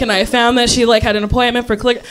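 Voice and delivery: ordinary talk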